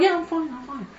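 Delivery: high, small, soft voice; reassuring bride. Acted. Bride to worried groom after the silence, softly reassuring. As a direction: lightly brush off the worry, trailing away.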